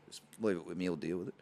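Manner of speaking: low, soft, imitated officious gravity; real serious. Security guard assuring a customer, imitated real serious. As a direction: play a self-important security guard, hushed and low. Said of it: "he's real serious".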